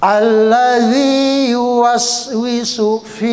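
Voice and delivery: zal as th sound. Shown as a demonstration. Teacher modelling the zal sound, slow chant. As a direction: bite the tongue tip for the z in "Allazi".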